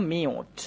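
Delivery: মিউট said softly as "miut" with a long "iu"; pronunciation demo. Shown as a demonstration. Teacher showing students how মিউট sounds, soft and clear. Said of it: "এটা উচ্চারণ করতে হবে মিউট".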